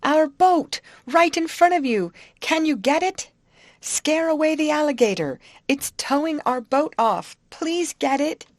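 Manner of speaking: loud, high pleading shout; appeal for help. Acted. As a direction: call urgently to someone far off. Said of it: "Molly raised her voice in an appeal for help".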